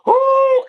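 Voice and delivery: very high, loud mock crowd whoop; loud "oh" reaction. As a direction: reenact a fan's big whooping reaction. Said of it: "you were like".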